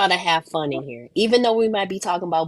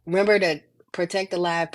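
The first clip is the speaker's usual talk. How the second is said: ordinary talk, nothing marked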